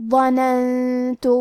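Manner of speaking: ظننت drawn out very slowly, nasal hum held on the hidden نون; slow demo reading. Shown as a demonstration. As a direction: stretch ظننت syllable by syllable with ghunnah. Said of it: "یہ حکم کو ادا کریں گے".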